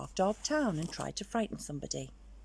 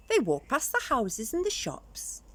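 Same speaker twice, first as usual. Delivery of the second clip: plain reading voice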